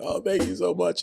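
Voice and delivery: high, grateful thank you; emotional thanks. Retold or imitated. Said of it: "I'm being all emotional".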